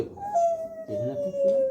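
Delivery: high sing-song coaxing; high soothing voice. Real, not acted. Father coaxing son down. (صدای زیر و نوازشگرانه برای آرام کردن)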